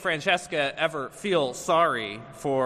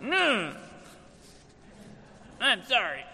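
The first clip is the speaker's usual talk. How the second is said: high whiny grunt, then grudging sorry; reluctant child's apology; 6 semitones higher, 3 dB quieter than usual. Retold or imitated. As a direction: imitate a kid forced to apologise. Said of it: "say you're sorry".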